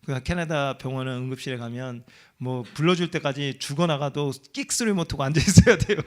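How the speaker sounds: ordinary delivery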